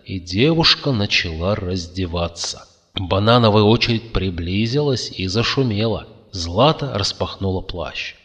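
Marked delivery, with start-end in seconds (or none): none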